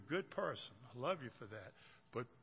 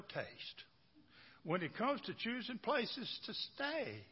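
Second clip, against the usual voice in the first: higher, gossipy wife voice; lightly voiced complaining wife; 6 semitones higher than usual. Wife complains about husband to friends, higher. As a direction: confide a gripe, pitched up.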